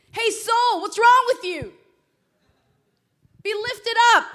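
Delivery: high, loud call to one's soul; rousing preacher's shout; commanding shout. Acted. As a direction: order it sharply at full volume. Commanding own soul, like David.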